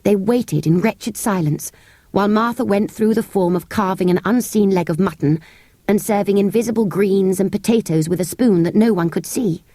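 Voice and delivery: usual speaking voice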